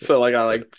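ordinary delivery

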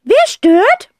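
very high, loud snapping phone answer; mock grumpy; gruff snap. A narrator's reading. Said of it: "raunzt Tante Rotz".

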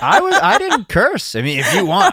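high laughing protest. Real, not acted. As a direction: giggle through it. Laughing defence.

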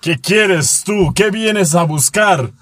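slow, drawn-out dramatic demand; hammed-up challenge, stretch each word out; telenovela confrontation. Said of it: "una voz de novela".

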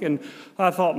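ordinary talk

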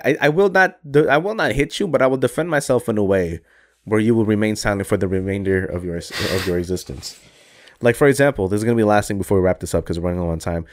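normal talking voice

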